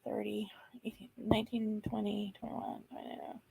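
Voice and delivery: counting the years in a whisper; quiet whisper count. Real, not acted. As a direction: whisper the numbers to yourself. Counting years under her breath.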